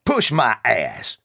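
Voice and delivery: loud, slow, high scoffing challenge; challenging. Acted. Angry soldier rejecting the kid's excuse. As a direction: throw it back in his face.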